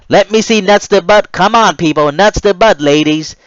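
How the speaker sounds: loud, high barked commands; drill yell. Retold or imitated. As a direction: yell at the recruits to close ranks. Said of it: "another R D C yelled out".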